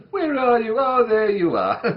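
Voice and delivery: high, loud, laughing peekaboo; playful peekaboo. Acted. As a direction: squeal it out as if finding a hidden toddler. Peekaboo with child under crown.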